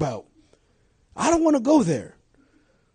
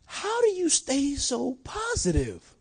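slow, soft, wondering coworker voice; amazed coworker; 3 semitones higher, 7 dB quieter, slower than usual. Retold or imitated. Coworker asking the pastor, slow and softly amazed. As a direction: marvel at him. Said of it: "they stop and go".

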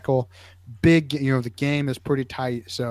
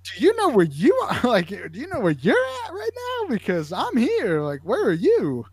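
high-pitched imitation of a baffled kid; incredulous kid; 10 semitones higher than usual. Kid turning the medic's question back on him, imitated high incredulity. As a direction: throw the question back in a squeaky, indignant mock voice. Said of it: "looking at me like I'm an idiot".

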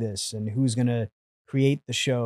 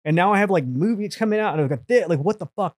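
panicked venting; 7 semitones higher, 4 dB louder than usual. Retold or imitated. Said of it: "and I'm like".

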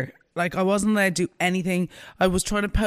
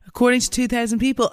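ordinary talk

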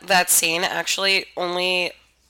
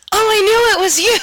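casual unfazed; 8 dB louder, faster than usual. Retold or imitated. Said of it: "he's just like".

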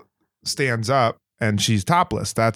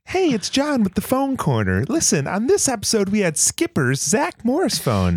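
high, slow, hammed-up announcer; mock segment host; 11 semitones higher than usual. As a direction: introduce your segment like an over-keen host. Parodies a radio segment host.

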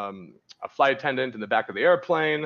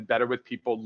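ordinary talk, nothing marked

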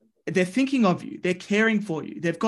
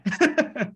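higher burst of real laughter; 8 semitones higher than usual. Real, not acted. Guest laughing after finishing the story, high laugh. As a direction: let out a bright laugh.

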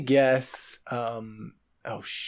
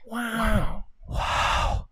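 breathy wow; 4 semitones higher, 3 dB quieter than usual. Acted. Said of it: "It's kinda breathy".

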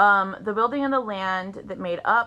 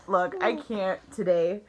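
ordinary delivery

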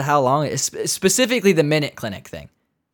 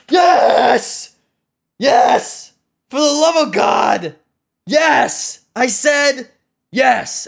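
louder, higher furious yes; yelling at phone robot; 7 semitones higher, 3 dB louder, much slower than usual. Retold or imitated. Caller losing it at voice menu, furious shouting. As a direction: scream it at the automated guy. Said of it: "who are you talking to like that".

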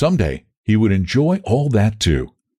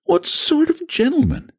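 put-on very high, inquisitive girl; high-pitched, girlish challenge; girl's query. A narrator's reading. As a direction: ask in a high feminine voice. Girl questions Val.

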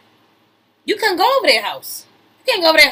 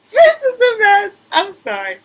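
much higher, louder, slow, near tears from laughter; laugh-crying; 7 semitones higher, 4 dB louder than usual. Real, not acted. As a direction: lose it laughing. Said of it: "crying laugh".

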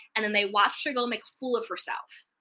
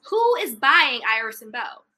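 ordinary talk, nothing marked

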